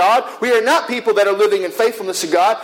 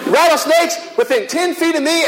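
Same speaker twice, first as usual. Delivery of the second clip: exclaiming; 6 semitones higher than usual. Real, not acted. Alarmed at snakes nearby.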